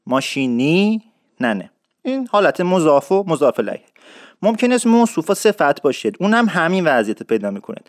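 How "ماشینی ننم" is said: slow Isfahani ماشینی ننم with drawn-out ی linking; Isfahani accent. Shown as a demonstration. Teacher demonstrating Isfahani speech to learners.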